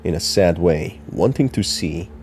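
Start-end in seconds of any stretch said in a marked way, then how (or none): none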